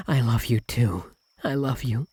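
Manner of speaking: usual speaking voice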